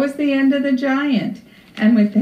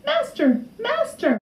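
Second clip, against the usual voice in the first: very high little harp voice; harp calling out; 13 semitones higher than usual. A narrator's reading. Stolen harp calls to the sleeping giant, high-pitched cry. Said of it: "the harp called out".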